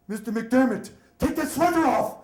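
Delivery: high, loud commanding order; stern nun's shout. Retold or imitated. Nun yelling at nervous boy to remove sweater, loud and stern. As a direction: bark it like a strict nun at a student.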